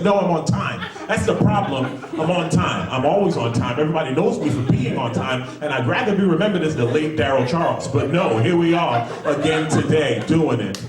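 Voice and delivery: high, hammed-up exasperation; exasperated self-complaint. Depressed man explains himself to bedmate, high and exasperated. Acted answer to the startled lady.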